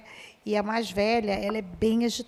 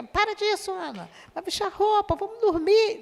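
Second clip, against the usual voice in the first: scolding yell; 10 semitones higher than usual. Retold or imitated. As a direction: bark it at the child like the old way. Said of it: "porque se fosse". (bronca imitada, bem mais aguda e alta)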